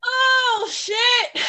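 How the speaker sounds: real high-pitched startled exclamation; caught off guard. Real, not acted. Co-host suddenly asked for her advice, caught off guard. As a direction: blurt it high, put on the spot. Put on the spot for her turn.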